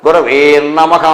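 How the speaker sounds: "గురవే నమః" dragged into one word, guraveennamaha; mispronounced run-together words. Retold or imitated. Teacher ridiculing a common slip, "గురవే నమః" wrongly fused. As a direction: exaggerate the wrong doubled n joining "గురవే నమః". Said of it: "ఏ నమః ఏంటి అక్కడ?".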